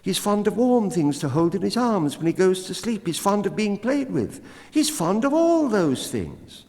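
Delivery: very high, fast squeaky bat voice; tiny creature rushing out the news; the bat, chattery. A narrator's reading. Bat hurries to tell the cat about the baby, high and fast.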